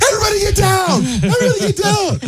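very high, strained mock yell; hammed-up alarm cry; mock emergency shout. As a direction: imitate firefighters fleeing a house in panic. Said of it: "come out of the house going".

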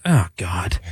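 low, soft groan of dismay; exasperated sigh. Retold or imitated. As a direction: imitate a weary dad's muttered dismay. Uncle's weary reaction in story.

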